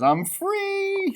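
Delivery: high retold leprechaun cry; retold freedom cry. Teacher retells the leprechaun's escape cry, high. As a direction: echo his cry of freedom. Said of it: "he says".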